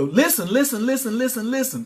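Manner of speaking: rising repeated listen; insistent calling. Real, not acted. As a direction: grab their attention. Demands attention.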